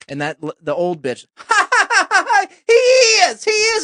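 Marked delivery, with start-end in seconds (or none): 1.4-2.5 shrieking cackle. Retold or imitated. Old lady laughs at the joke. 2.7-3.8 shrill, high, loud insistence; excited old lady. Retold or imitated. Old lady shrieks at the table that he is.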